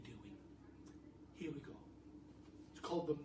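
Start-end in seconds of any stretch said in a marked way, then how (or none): none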